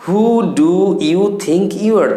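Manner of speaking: very slow, every word laid out clearly; slow model phrase. Shown as a demonstration. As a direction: say it slowly so learners can copy it. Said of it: "ইংরেজি আপনি যদি বলতে চান তাহলে বলবেন". (ধীরে ধীরে স্পষ্ট করে বলা)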